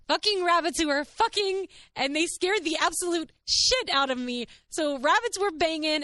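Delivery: high, loud comic indignation; exasperated outburst. Real, not acted. Telling listeners what scared her, high exasperated outburst. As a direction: let the absurd reveal burst out.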